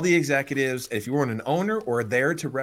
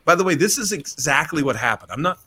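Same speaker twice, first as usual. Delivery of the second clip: ordinary talk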